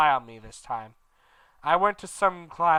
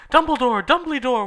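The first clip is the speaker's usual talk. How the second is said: much higher, louder calls; yelling; 10 semitones higher, 8 dB louder than usual. Acted. Ebony and Vampire yelling for Dumbledore. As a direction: call him urgently up the stairs. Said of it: "we both yelled".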